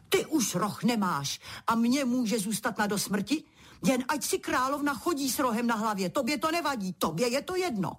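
mock yelling. A narrator's reading. Queen grabs the king by the neck, mock yelling. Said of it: "začala křičet".

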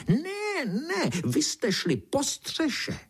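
very high, drawn-out squealing objection; screechy, slow beetle contradiction; beetle's shrieking. A narrator's reading. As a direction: shriek each slow word in protest. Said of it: "černý brouk vřískal dál".

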